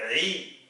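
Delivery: ri as a throaty Arabic aïn plus an i vowel; aïn with kasra. Shown as a demonstration. Teacher demonstrating aïn with kasra, throaty i. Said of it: "avec la kasra".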